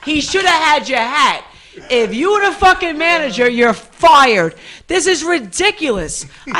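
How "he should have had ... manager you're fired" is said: loud, high mock rant; mock outburst. Host mock-firing the manager over a hat, loud. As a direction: rant at him half-joking, voice up.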